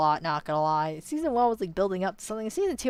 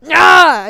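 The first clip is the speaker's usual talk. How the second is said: loud, high cry of frustration; frustrated yell; 9 semitones higher, 17 dB louder than usual. Real, not acted. Viewer yells in frustration at the ending. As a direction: let out a sharp yell. Frustrated outburst while reacting.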